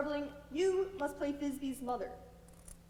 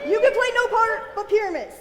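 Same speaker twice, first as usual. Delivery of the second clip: much louder, higher insistence; loud insisting; 8 semitones higher, 15 dB louder than usual. Acted. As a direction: shout it down firmly. Quince insisting loudly.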